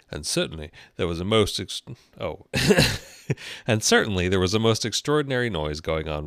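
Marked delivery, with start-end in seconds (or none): 2.5-3.0 short real laugh; quick, high, loud chuckle; laugh at slip. Real, not acted. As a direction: burst out laughing briefly at your own slip. Laughs at own misreading.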